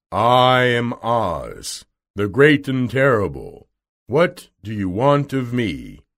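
booming, drawn-out mysterious wizard; slow, loud, grand opening; Oz, great and terrible. Acted. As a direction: declaim like an imposing unseen wizard. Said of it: "At last they heard a voice".

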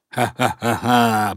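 slow, deliberate villain cackle; spaced-out nasty "ha-ha" laughter; nasty cat laugh. A narrator's reading. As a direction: laugh mockingly as a mean tomcat. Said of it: "противно засмеялся кот Васька".

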